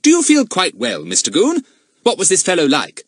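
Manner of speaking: put-on high, loud well-mannered boy; polite boy voice. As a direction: answer like a good schoolboy. Narrator voices Fatty.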